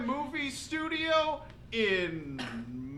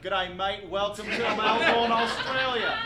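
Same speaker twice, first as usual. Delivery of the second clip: broad Australian greeting, louder; Australian accent; 3 semitones lower, 4 dB louder than usual. Acted. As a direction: leichter australischer Akzent. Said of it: "use my real accent".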